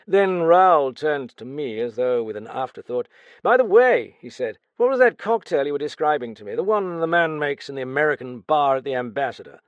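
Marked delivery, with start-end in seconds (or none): 3.4-4.2 higher, louder offhand opener; casual afterthought. Acted. Raoul asking his friend, offhand. 4.8-9.6 higher, louder offhand opener; casual afterthought. Acted. Raoul asking his friend, offhand.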